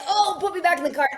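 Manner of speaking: high, loud mock wail; pleading injured dog. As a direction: voice a hurt dog begging in a rush.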